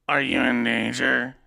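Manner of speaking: slow, eerie ghoulish female voice; drawn-out spooky woman's voice. Acted. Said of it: "that ghoulish voice for, uh, Elora".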